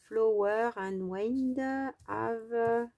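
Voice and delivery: English read haltingly with a French accent; slow, French-accented English, drawn-out syllables. Real, not acted. Vlogger reading a product name in English, strong French accent. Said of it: "pardon pour mon accent".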